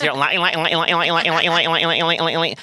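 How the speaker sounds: loud gobbling run of words; turkey on ecstasy. Retold or imitated. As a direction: gabble it over and over like a manic turkey. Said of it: "sounded just like a fucking, uh, turkey".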